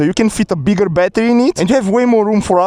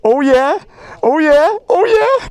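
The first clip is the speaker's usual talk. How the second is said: building cheer; 6 semitones higher, slower than usual. Real, not acted.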